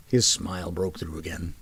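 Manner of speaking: ordinary delivery, nothing marked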